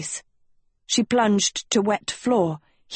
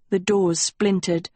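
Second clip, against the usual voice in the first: plain reading voice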